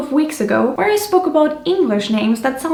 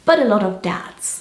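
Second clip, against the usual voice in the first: normal talking voice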